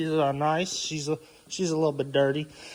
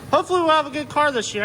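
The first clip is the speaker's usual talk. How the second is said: excited; 10 semitones higher, 5 dB louder, much faster than usual. Real, not acted. Hopeful closing, sudden high fast.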